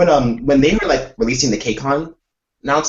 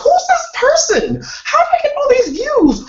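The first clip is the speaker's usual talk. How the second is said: very high, slow bewildered voice; high-pitched mock confusion; baffled squeak. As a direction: squeak it out in disbelief. Retold baffled reaction to views.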